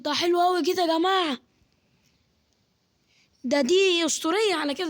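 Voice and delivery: high, lively delight; delighted praise. Real, not acted. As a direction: gush about the new power. Delighted with the fruit.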